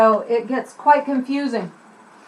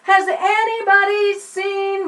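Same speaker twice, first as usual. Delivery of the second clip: calling out; 8 semitones higher, slower than usual. Shown as a demonstration. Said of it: "they call out to the".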